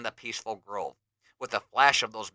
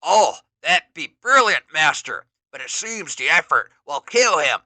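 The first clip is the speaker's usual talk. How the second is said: beastly underling; 3 semitones higher, 8 dB louder than usual. Acted. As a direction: grunt out the worry. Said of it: "beastly underling replies".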